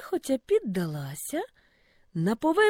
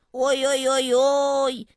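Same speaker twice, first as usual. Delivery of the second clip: slow drawn-out wail of pain; snowdrift crying out; 4 dB louder, slower than usual. A narrator's reading. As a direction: howl as the sun burns. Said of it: "закричав замет". (повільне зойкання замету)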